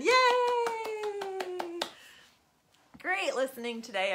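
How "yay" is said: high, long-held hooray; drawn-out celebratory cheer. Real, not acted. Cheers the end of story.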